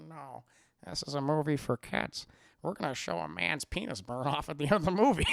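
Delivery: low, dry voice of a director; put-on refusal. Mimics George Lucas refusing actor.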